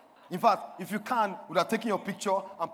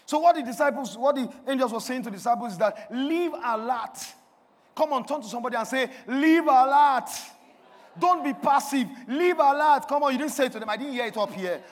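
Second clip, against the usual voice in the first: raised, urgent pulpit voice; forceful preaching; 5 semitones higher, 7 dB louder than usual. Real, not acted. Preacher urging the congregation to turn to each other, forceful preaching. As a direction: rouse the room with loud commands.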